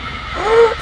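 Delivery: loud, high intake of breath; big gasp of sudden relief; startled gasp. Real, not acted. Player seeing the landing, startled gasp.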